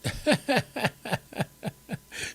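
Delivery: long, high-pitched chuckle; real staccato laughter, trailing on; amused laugh. Real, not acted. Laughs at a viewer's comment.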